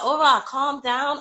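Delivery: high, slow sing-song to calm a pet; soothing the dog. Real, not acted. Owner calming her excited dog Aura, high and slow. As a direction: coo at her slowly to settle her down.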